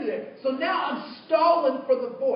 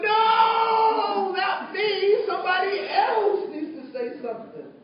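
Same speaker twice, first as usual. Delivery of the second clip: loud, high protest; panicked refusal; 8 semitones higher, 5 dB louder, slower than usual. Retold or imitated. Speaker refusing the inner voice, loud high protest. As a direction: push the job off on someone else, loudly. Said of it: "I'm like".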